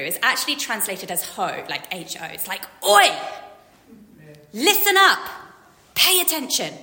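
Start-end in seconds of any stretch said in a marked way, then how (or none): 2.9-6.7 loud, high market holler; punchy street-seller call; vendor's shout. Acted. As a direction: shout to get the crowd's attention. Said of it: "marketplace vendor shouting to get your attention".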